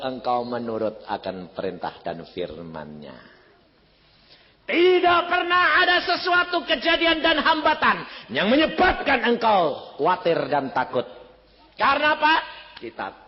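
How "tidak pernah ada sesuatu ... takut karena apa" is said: loud, high sermon voice; fiery preaching. Acted. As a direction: preach it out to the hall. Preaching at full force. (khotbah berapi-api)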